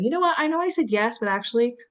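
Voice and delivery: high, loud, rushed blurt of an excuse; imitating a flustered cancel. To a friend, cancelling plans: a high flustered blurt. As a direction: burst out sheepishly with the bad news.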